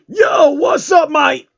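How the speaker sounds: high, loud, slow mock rapper greeting; hammed-up gangsta hello; rapper shout. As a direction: yell it like a hyped-up rapper.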